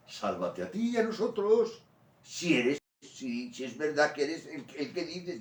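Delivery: high, loud scornful cry; mocking thief shouting. Dying thief taunts Jesus, high and loud. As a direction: throw it at him as a taunt from the cross. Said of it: "Hay que ponerse en, en el puesto de él".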